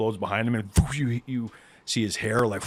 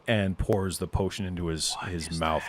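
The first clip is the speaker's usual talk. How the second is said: ordinary talk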